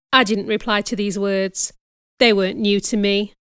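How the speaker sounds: usual speaking voice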